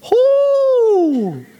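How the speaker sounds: a loud, sky-high whoop of delight; excited whoop. Real, not acted. Preacher whoops to the congregation, loud and high. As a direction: let out a joyful cheer, way up high. Preacher cheering over inheritance.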